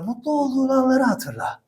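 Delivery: imitating a psychologist, dropping to a soft voice; mock therapist voice. Coach mocking a psychologist's advice, soft imitation. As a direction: hush it like a calm therapist. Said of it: "ses tonunu da böyle indirir".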